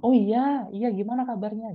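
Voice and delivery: high-pitched, eager "Oh iya" greeting; high intonation. Shown as a demonstration. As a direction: lift the pitch way up as you greet. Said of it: "tinggi sama rendahnya".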